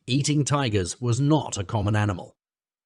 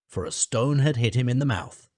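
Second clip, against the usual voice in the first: usual speaking voice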